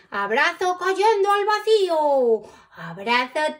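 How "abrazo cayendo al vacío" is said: falling cry. A narrator's reading. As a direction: call it out as if dropping away. Names a hug falling away.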